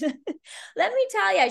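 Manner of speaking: high, slow, drawn-out let me tell ya; hammed-up spunky old woman; sassy old lady. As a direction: savour it like a cheeky grandma.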